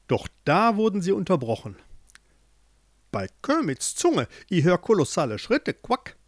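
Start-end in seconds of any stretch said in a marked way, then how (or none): 3.1-6.2 warning. A narrator's reading. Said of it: "warnte die Mutter".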